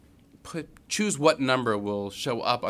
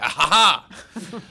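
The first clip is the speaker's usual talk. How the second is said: gotcha; 7 semitones higher, 6 dB louder than usual. Retold or imitated. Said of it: "I'd be able to go".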